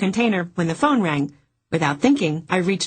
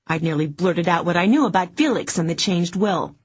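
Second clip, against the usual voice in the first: ordinary delivery, nothing marked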